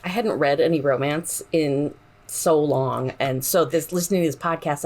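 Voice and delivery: usual speaking voice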